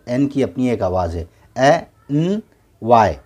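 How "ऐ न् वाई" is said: ए, एन, वाई one sound at a time; sounded out separately. Shown as a demonstration. Teacher shows each letter's own sound, ए, एन, वाई separated. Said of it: "ए अपनी एक आवाज़ है".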